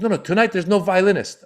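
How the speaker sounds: high-pitched urgent refusal; frantic promoter. Retold or imitated. Sweating promoter correcting the comedians, high and frantic. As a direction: cut them off in a panic. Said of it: "He's sweating".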